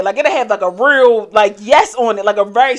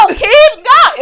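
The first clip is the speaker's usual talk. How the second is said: high loud cheer; 14 semitones higher, 11 dB louder than usual. Retold or imitated.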